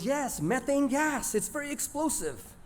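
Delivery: emphatic mom. Retold or imitated. Mom explaining to the boys.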